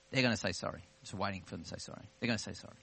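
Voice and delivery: low, quick self-reassurance under the breath; stubborn inner waiting. Retold or imitated. Preacher replaying his wait for an apology, quiet inner voice.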